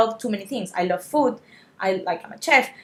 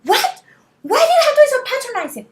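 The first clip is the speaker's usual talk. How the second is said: high-pitched, loud indignant outburst; acted-out furious retort, fast and high; retold snapping; 8 dB louder, faster than usual. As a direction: snap back fast and outraged, voice shooting up. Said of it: "I just snap at him".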